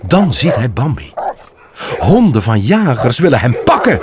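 plain reading voice

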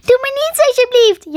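very high, loud pleading; squeaky, whimpering plea; wailing squirrel. A narrator's reading. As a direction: beg like a terrified small animal. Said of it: "jammerde het diertje".

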